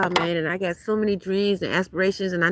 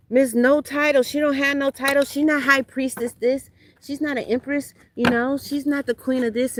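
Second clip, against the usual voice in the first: higher, sing-song mock of her critics; mocking the haters; 6 semitones higher, 3 dB louder than usual. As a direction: sneer it like the people who copy her. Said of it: "try to make it seem like".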